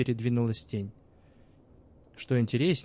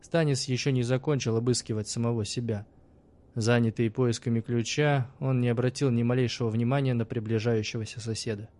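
plain reading voice

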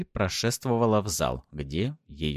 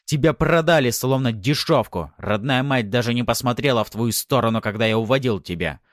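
loud, raised, contemptuous man; angry retort; 5 semitones higher, 6 dB louder than usual. A narrator's reading. Husband telling wife her family sold her, angry. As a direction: drive each hurtful fact home. Husband shouts back in quarrel.

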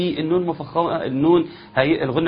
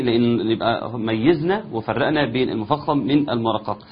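ordinary talk, nothing marked

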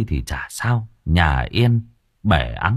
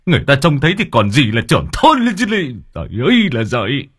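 frantic; 5 semitones higher, faster than usual. A narrator's reading. Village chief begging wife for a quick bath. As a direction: rush her in a panic. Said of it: "Ông rít lên".

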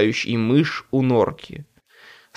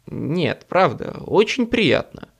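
ordinary delivery

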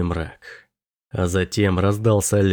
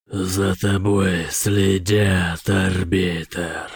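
demon voice; 2 semitones lower, much slower than usual. A narrator's reading. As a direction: warn slowly like a demon. The demon warns the arbiter.

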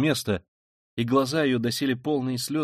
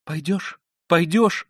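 high, urgent rising questions; frenzied pleading; 7 semitones higher, 6 dB louder, slower than usual. Acted. Sonya pressing him to go, as in a fit. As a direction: press again, louder the second time. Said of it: "спрашивала она его, вся дрожа, точно в припадке".

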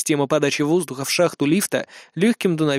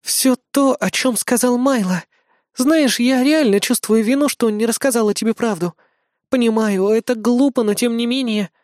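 sad; 8 semitones higher than usual. Acted. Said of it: "стала максимально грустной".

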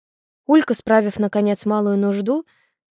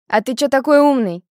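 louder, higher-pitched sarcastic jab; snide; 4 semitones higher, 7 dB louder than usual. A narrator's reading. Girl needling a know-it-all boy, snide. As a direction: needle him with a raised, snarky question. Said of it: "съязвила Улька".

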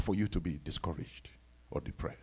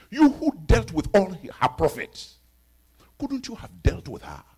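usual speaking voice

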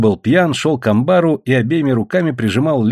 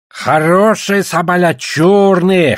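slow, louder, raised boast; boastful; 6 semitones higher, 4 dB louder, slower than usual. A narrator's reading. Trader boasting about his sables, boastful. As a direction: show off your goods with drawn-out pride. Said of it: "хвастался Покпа".

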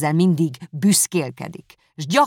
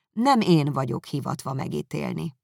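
plain reading voice

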